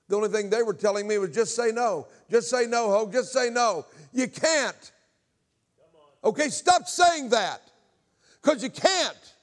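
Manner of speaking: very high, loud, impassioned shout; full-force preaching. Real, not acted. Preacher refutes just say no, impassioned shouting. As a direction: drive it home to the crowd, stretched out.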